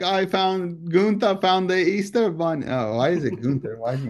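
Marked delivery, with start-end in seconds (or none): 0.0-2.6 higher-pitched, hammed-up German accent; clumsy cartoon German accent, pitched up; horrible German accent. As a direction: put on a bad German kid's accent. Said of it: "that's a horrible accent".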